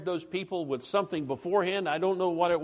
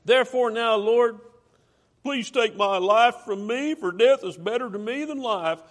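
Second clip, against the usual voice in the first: louder, higher whining plea to die; whiny plea; 4 semitones higher, 5 dB louder than usual. Retold or imitated. Jonah begging God to take his life, whiny. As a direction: beg dramatically like a sulking whiner. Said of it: "He's just a whiner".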